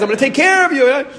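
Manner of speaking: high, loud, half-laughing enthusiasm; gushing newlywed. Acted. New husband promising his wife care, high excited gushing. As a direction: gush like an over-eager new husband.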